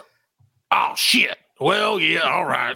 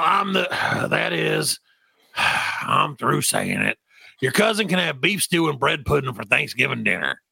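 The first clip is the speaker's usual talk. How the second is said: usual speaking voice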